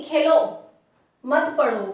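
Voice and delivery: loud खेलो, clear break, then मत पढ़ो; pause after Khelo. Shown as a demonstration. Teacher showing where to stop, pause after Khelo. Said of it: "अब यहाँ पे रुकेंगे".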